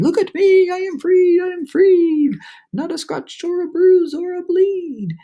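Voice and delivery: high, loud triumphant chant; exultant. A narrator's reading. Centipede celebrating freedom, high loud chant. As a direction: shout the rhyme with glee.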